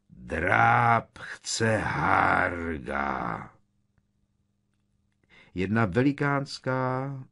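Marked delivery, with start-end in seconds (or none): 0.1-3.5 very slow, drawn-out giant voice; booming giant. Acted. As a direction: stretch each word like a huge simpleton. Giant demands Hagrid in story.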